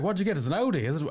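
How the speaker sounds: a mock Cork comedian quizzing him; bad Cork accent. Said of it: "gonna do the accent wrong".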